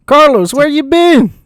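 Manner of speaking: loud hail across the yard; calling out. Acted. Brother hailing missing Carlos, loud call.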